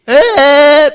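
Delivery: appel crié, beaucoup plus aigu et fort; héler les pêcheurs de l'autre rive; crier au loin. Retold or imitated. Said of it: "je commence à crier". (appel crié très aigu et fort)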